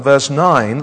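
ordinary delivery

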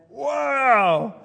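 loud, high burst of wonder; amazed exclamation. Acted. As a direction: burst out with an astonished wow. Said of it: "She said".

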